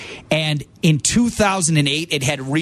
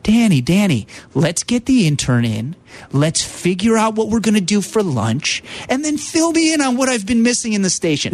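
higher, coaxing imitation of a calm friend; J D's calming voice; 4 semitones higher than usual. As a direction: soothe him like J D would. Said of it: "that calming voice".

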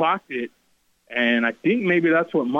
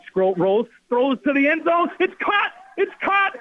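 excited shout; 14 semitones higher, slower than usual. Real, not acted. Announcer calls winning touchdown.

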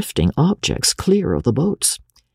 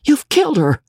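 high, aghast accusation; panicked young doctor; horrified cry; 3 dB louder than usual. A narrator's reading. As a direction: cry out in alarm, voice leaping up. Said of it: "cried the young medico".

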